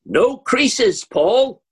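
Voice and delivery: high, loud heckle from the audience; aunt in the audience shouting up to the stage, high and loud; aunt calling out. Retold or imitated. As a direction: ring out across the theatre. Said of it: "my aunt's voice rings out".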